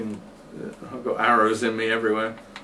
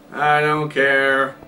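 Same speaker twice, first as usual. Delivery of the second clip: normal talking voice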